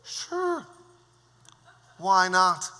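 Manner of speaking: mock royal shrug; king's careless reply. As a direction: toss it off like a careless king. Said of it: "You know what the king said?".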